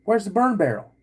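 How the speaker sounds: high-pitched retold question; kid blurting question. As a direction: imitate your younger self blurting it out to dad. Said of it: "the first things out of my mouth".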